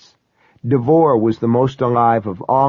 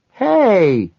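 high, drawn-out cawed call; high-pitched carny holler; cawing carny woman; 8 semitones higher, 3 dB louder than usual. A narrator's reading. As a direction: caw it out like a ticket-booth woman. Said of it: "the woman in the ticket booth cawed".

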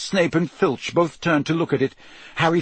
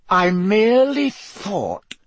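higher, slow, strained calm; forced calm; 8 semitones higher, 4 dB louder, slower than usual. A narrator's reading. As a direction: hold your temper in check. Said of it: "in a voice of forced calm".

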